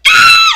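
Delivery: piercing high fangirl scream. Retold or imitated. Fan whose table got picked, piercing scream. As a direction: shriek at full pitch in imitation.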